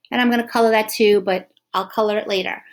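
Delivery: normal talking voice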